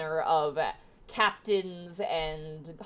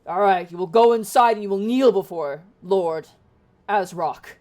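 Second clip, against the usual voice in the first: louder, commanding guard voice, arms crossed; stern hobgoblin guard; 11 dB louder than usual. Acted. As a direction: lay down the law to the adventurers. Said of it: "hobgoblin turns to you and crosses arms and goes".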